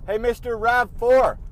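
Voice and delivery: loud, high taunting yell; angry shout. Retold or imitated. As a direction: shout it at the other driver. Yelling at another driver.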